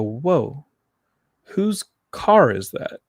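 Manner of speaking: retold double take, drawn out; stunned surprise. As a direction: stop short, then ask slowly. Retold shock at brother's car.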